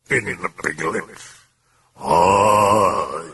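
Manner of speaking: slow, strange demon drawl. Acted. Demon taunts Karras, slow and eerie. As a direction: speak as the mocking demon.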